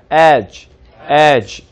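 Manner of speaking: "edge" said slowly with an e vowel; "edge" with a clear e sound. Shown as a demonstration. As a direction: model "edge" carefully. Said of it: "이 발음도 에 발음이죠".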